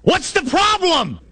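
high, drawn-out yell over the wind; strained shout, each word stretched; yelling back. Acted. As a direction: holler it across a stormy boat. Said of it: "he has to yell back".

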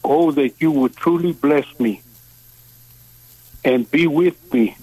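usual speaking voice

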